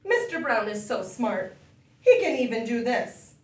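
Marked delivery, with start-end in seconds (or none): none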